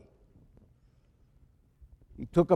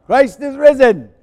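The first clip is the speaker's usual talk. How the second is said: higher and louder joyful cry; rousing proclamation; 7 semitones higher, 9 dB louder than usual. Real, not acted. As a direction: shout it out to rally the people. Final Paschal greeting, loudest.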